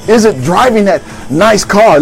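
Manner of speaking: ordinary talk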